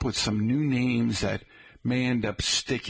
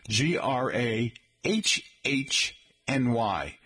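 slow spelling; slower than usual. Shown as a demonstration. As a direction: spell it out letter by letter, slowly. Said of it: "for you folks spelling Granny".

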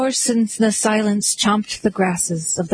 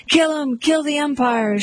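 mock shout; 7 semitones higher than usual. A narrator's reading. Someone in the stand at the umpire, mild high shout. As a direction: call it out high, half-shouting. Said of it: "shouted someone in the stand".

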